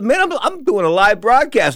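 high, loud, slowed objection; retold indignant brush-off to an interruption; exasperated protest. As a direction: protest like you can't be bothered now. Interrupted announcer protesting to intruders.

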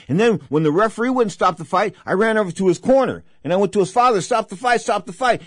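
high, loud, urgent storytelling; agitated retelling. Real, not acted. Host reliving begging the father to stop the fight. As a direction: rush it out, reliving panic. Emotional retelling, yelling at corner.

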